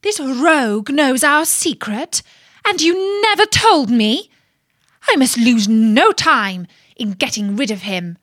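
loud, high, scandalised sister; indignant outrage. A narrator's reading. Outraged sister learns secret is known.

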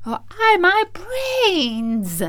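high, drawn-out tearful wail. A narrator's reading. Scarecrow mourns the promised brains, wailing. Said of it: "wailed the Scarecrow".

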